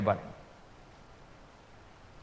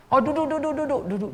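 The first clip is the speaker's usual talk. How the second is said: eager welcome; 14 semitones higher, 5 dB louder than usual. Retold or imitated. Eagerly inviting the guest to sit.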